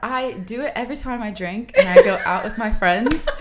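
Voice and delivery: laughing through a British accent; chatty British accent with a laugh. Shown as a demonstration. Telling the host about drinking with friends, British accent. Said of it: "I can speak in it".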